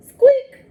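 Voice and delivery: high loud toy squeak; rubbery high squeaky toy. Acted. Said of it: "says the little rubber duck".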